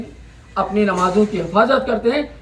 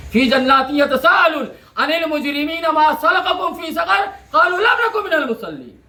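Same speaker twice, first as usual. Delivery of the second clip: intoned Arabic verse, climbing higher at the end; Quranic recitation; 4 semitones higher than usual. Acted. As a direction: chant the verse as tilawat. (क़ुरआन की तिलावत, ऊँचे सुर में)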